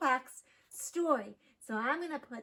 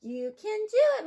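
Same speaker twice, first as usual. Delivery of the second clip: high, louder cheering-on voice; encouraging mother duck; 8 semitones higher, 7 dB louder than usual. A narrator's reading. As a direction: urge the scared ducklings on. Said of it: "Mama said".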